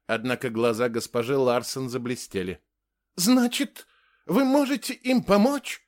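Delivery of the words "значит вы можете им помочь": very high, eager question; hopeful. A narrator's reading. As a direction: ask with hope rising in your voice. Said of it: "с надеждой спросила Ингрид". (с надеждой)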